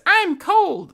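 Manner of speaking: high squeaky teddy bear voice; squeaky toy voice. Pretend. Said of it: "it says".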